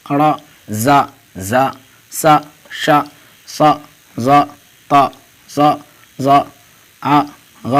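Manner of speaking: each letter with an "aa" sound, as in "با، تا"; zabar vowel drill. Shown as a demonstration. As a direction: recite "ا، با، تا" one by one, open "aa", pause after each. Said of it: "زبر کی حرکت لگاتے ہیں تو آ کی آواز". (حروف "ا، با، تا" زبر کے ساتھ، آ کی آواز میں الگ الگ)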